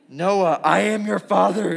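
loud mock villain voice; hammed-up menacing announcement; Darth Vader. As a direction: boom it out like an angry movie villain. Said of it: "my Darth Vader voice".

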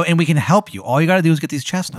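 helpers' pitch. Acted. GM voices helpers offering deal.

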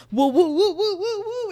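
rising startled whoas; mock alarm. Imagined demon yanked from TV time, mock alarm. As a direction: act out being yanked away. Demon pulled into another dimension.

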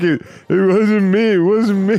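high, loud mocking imitation; mock protesting caller. Host mimics the kicked caller for laughs, mock protest. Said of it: "He's like".

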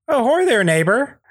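high-pitched, folksy neighbor greeting; Andy Daly. Acted. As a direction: hail the neighbor in a chirpy high voice. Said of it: "is played by Andy Daly".

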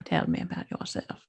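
whispered, seductive vintage purr; Katharine Hepburn voice. Pretend. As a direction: breathe it out like an old film siren. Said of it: "not even a very good Eleanor of Aquitaine".

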